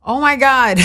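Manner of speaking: high, loud embarrassed oh my God; surprised laugh. Real, not acted. Asked if she's single, high loud surprised laugh. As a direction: caught off guard, laughing.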